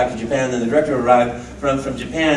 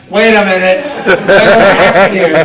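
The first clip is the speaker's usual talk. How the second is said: loud, raised, put-on disbelief; mock astonishment; 4 semitones higher, 8 dB louder than usual. Actor learns he plays the short fat guy, mock astonished. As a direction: act out a comic double take, louder and higher. Acted reaction to unflattering casting.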